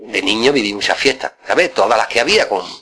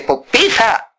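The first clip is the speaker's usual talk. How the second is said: much higher mock teacher voice, slow then barked; demanding uncle ordering him to press the string, high; high stern uncle; 10 semitones higher, much slower than usual.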